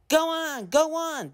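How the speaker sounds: very high, giggly truck voices; silly giggling trucks. Acted. Trucks pushing Thomas downhill, giggling very high. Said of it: "they giggled in their silly way".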